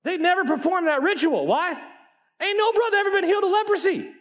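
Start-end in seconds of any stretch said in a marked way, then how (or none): 2.4-4.1 very high, fast outburst; preacher's high, quick punchline; excited exclamation. Real, not acted. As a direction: exclaim it in a high-pitched rush.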